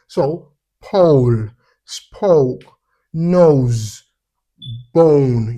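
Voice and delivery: pole, spoke, nose, bone with a long drawn O, loud and slow; long O words. Shown as a demonstration. Teacher drilling magic E words, loud and slow. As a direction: stretch the O in each word. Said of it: "take note of the magic E".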